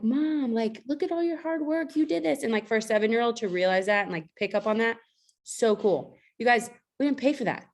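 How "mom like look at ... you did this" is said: put-on higher, childlike son's voice; voicing her young son. Her son praising her hard work, voiced higher and childlike. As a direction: sound like a moved seven-year-old. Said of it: "he started crying because he was just like".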